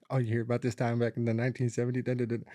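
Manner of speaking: fast imitated story patter; mock chatty rambling. Speaker mimics a houseguest parent launching into old stories, mock rambling.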